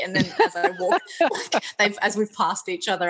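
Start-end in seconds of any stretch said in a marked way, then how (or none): none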